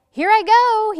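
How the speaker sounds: high excited shout. Acted. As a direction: yell it with glee as you launch. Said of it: "he shouted".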